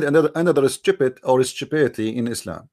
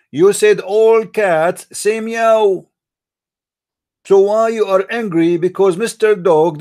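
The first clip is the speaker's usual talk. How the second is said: very slow and louder, each word pressed; slow emphatic challenge; 3 semitones higher, 4 dB louder, slower than usual. Real, not acted. Speaker mocking Allah's order to the cats, slow and loud. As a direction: drag it out like a prosecutor making his point.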